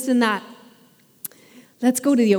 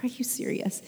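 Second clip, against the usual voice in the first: unvoiced, much softer exasperated whisper; whispered disbelief; 12 dB quieter than usual. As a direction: breathe it out in defeat. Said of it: "I'm like".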